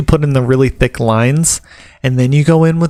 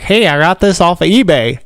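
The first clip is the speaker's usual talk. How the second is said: put-on Southern accent; 4 semitones higher than usual. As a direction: put on a mocking Southern twang. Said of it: "I'm doing the Southern accent".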